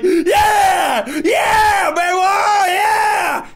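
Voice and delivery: loud high-pitched hollering imitation; hyped-up cheering. Imitating a domino fan at the event, hyped loud cheering. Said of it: "He's like ... He's hyped".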